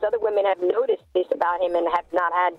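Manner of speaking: normal talking voice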